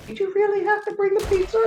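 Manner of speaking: high, fast mocking wife voice; imitating the wife. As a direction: squeak it out like a nagging wife. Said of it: "his wife is going".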